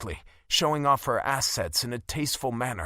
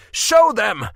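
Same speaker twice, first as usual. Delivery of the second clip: very loud, higher shouted order; 8 semitones higher, 11 dB louder than usual. A narrator's reading. Caspian shouting at Sarissa to show the crowd, loud shout. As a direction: shout her down. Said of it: "I shouted".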